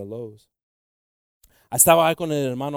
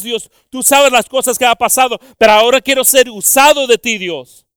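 fervent preaching prayer; 10 semitones higher, 14 dB louder than usual. Acted. Preacher pleading with God before the congregation, loud and fervent. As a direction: cry out to God to be used.

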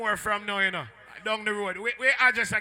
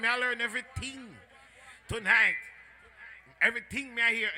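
ordinary talk, nothing marked

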